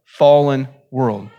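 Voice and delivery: usual speaking voice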